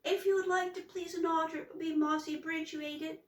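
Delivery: high, fast, tongue-tied fright; words tumbling out nervously; flustered. A narrator's reading. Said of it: "it came out all upside down".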